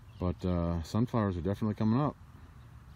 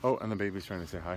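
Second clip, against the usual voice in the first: ordinary talk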